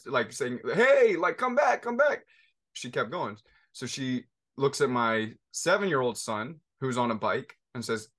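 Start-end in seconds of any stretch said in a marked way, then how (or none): 0.7-2.2 put-on high, loud calling after a runaway child; retold scream. Wife screaming at daughter on scooter, loud high call. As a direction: yell it up the hill, higher and louder. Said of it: "screaming at her".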